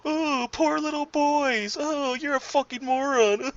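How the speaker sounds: very high, slow, whiny falsetto mock; mocking idiot voice. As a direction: whine it like a simpering fool. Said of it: "idiots like you that go out there and go".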